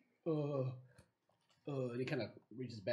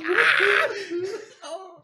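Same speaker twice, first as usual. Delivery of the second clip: high, loud battle shriek; wild attack scream; 14 dB louder than usual. Acted. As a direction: shriek as you leap on him. Stephanie attacks.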